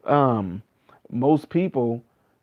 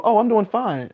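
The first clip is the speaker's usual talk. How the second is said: breezy reply; 8 semitones higher than usual. Retold or imitated. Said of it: "They go".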